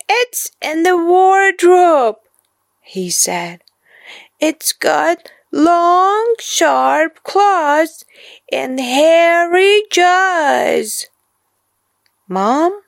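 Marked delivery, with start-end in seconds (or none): 0.1-2.2 put-on high, eager little boy; child's voice. A narrator's reading. Boy tells mom where the monster hides. Kipper's voice. 4.4-11.1 put-on high little boy, slowing at the end; child's voice. A narrator's reading. Boy describes the monster to mom. Kipper's voice.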